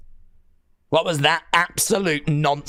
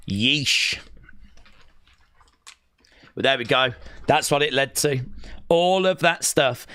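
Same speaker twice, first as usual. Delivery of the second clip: usual speaking voice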